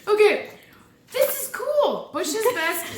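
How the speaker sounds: high, giddy, over-caffeinated; bubbling over with coffee jitters; too much caffeine. Real, not acted. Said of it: "I've had three cups of coffee already".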